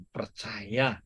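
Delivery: normal talking voice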